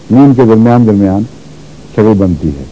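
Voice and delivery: ordinary talk, nothing marked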